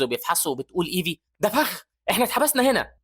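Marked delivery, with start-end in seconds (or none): 1.4-2.9 high-pitched alarm; alarmed realization. Acted. Evie trapped behind the barrier, alarmed. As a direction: raise the alarm about the trap.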